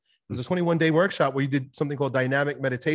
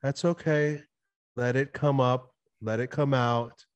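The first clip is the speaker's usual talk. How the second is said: slow, lower, soothing reassurance; unhurried and gentle, with pauses; very calm; 3 semitones lower, 4 dB quieter, slower than usual. Retold or imitated. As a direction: imitate a calm practitioner giving permission. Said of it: "she explains to me very calmly".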